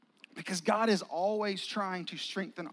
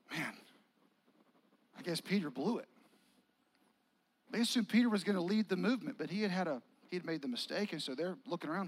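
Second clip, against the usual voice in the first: ordinary talk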